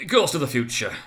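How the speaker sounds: a fearful cry to the phantom; exclaimed. A narrator's reading. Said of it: "he exclaimed".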